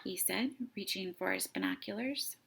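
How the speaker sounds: ordinary delivery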